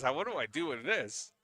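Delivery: loud mock bafflement; imitated bafflement. Commentator mimics Joe laughing over the shot, loud mock bafflement. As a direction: play the stumped player, loud and laughing along. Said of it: "He goes".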